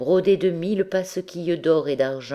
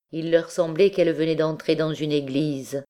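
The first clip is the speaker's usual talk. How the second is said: usual speaking voice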